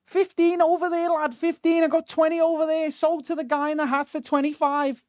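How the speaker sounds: put-on high Scouse auctioneer voice, Liverpool accent; Scouse accent, high and bright, selling to the crowd; Scouse Steven Gerrard. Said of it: "can you do a Stevie G".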